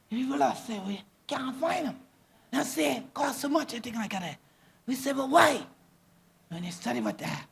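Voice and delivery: speaking in Gullah native tongue; lilting Gullah speech, as among island people. Real, not acted. Islander telling of sea and marsh, in Gullah. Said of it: "like I said in Gullah".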